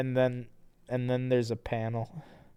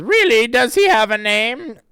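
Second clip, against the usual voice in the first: character voice; 11 semitones higher, 12 dB louder than usual. Acted. Mocking Chris's line.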